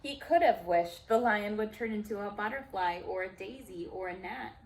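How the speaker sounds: ordinary delivery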